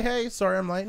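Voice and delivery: usual speaking voice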